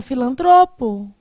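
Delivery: filantropo with a loud TRO; stress on TRO. Shown as a demonstration. Teacher showing the tonic syllable, filantropo stressed on TRO. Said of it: "Não é essa que é a sílaba tônica?".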